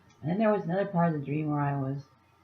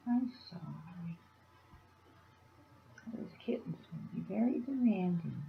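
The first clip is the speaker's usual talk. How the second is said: soft pet talk; 3 semitones higher, 6 dB quieter than usual. Real, not acted. Talking softly to her cat.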